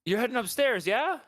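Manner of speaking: high-pitched questioning villager; townsfolk voice. Acted. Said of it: "a couple of the guards or the townsfolk are like".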